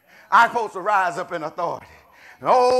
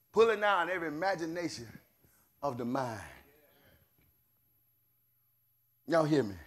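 much lower and softer, fading prayerful recitation; quiet scripture intoning; 7 semitones lower, 9 dB quieter than usual. Acted. Preacher reciting scripture to the church, low hushed intoning. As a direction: let it fall to a low hush.

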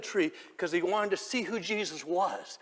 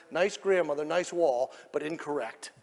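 ordinary talk

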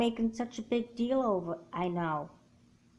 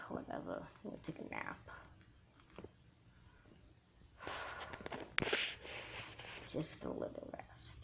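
soft, drowsy whisper; sleepy whisper; 3 semitones lower, 15 dB quieter than usual. Acted. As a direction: fade out as sleep takes over. Drifting off to sleep.

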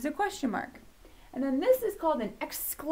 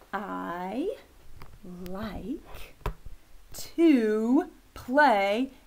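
word by word, drawn out, building louder; slow dictation that grows louder; slow modelling; 4 dB louder, much slower than usual. Shown as a demonstration. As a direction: stretch each word for children to follow. Teacher models sentence word by word.

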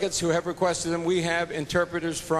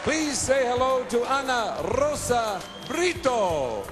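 pageant announcer; 9 semitones higher than usual. Acted. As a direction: call it out to the whole hall. Host introduces Miss Puerto Rico.

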